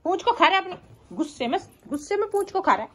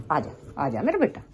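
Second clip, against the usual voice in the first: gentle coaxing; 11 semitones lower, 6 dB quieter than usual. Real, not acted. Coaxing the pet down.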